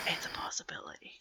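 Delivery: quick, barely-there whisper; hushed, hurried whisper; whispered aside. Acted. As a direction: whisper it under your breath. Murmured aside at table.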